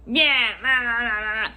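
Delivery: loud mock grumbling; mocking angry babble. Customer grumbling back at the clerk, mocking babble. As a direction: imitate a ranting customer with nonsense syllables.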